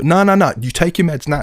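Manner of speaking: mock the wife insisting, no arguments; insistent wife. Wife insisting he takes meds.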